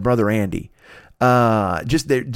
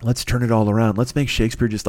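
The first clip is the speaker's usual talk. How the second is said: ordinary talk, nothing marked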